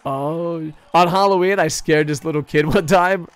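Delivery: usual speaking voice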